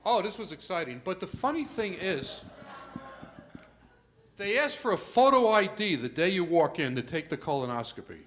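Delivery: ordinary talk, nothing marked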